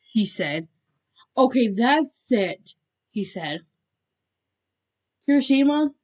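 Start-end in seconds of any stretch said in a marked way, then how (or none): 1.4-2.8 slow, loud, higher-pitched exasperation; fed-up declaration. A narrator's reading. Bakugo losing patience at the door, fed up. 5.3-5.9 raised, loud call. A narrator's reading. Bakugo calling his friend for help, calling out. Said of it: "he called".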